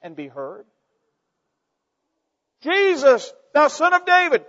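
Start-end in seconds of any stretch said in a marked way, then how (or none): 2.6-4.5 very high, loud desperate call; a beggar's frantic high cry. Acted. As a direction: strain to be heard over the crowd. Beggar keeps crying out.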